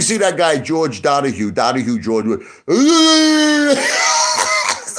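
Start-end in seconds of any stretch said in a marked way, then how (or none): 2.7-4.8 long, very high, loud yell; hammed-up protester wail; mock protest shriek. Mimicking protesters' yelling.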